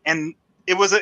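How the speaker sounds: ordinary talk, nothing marked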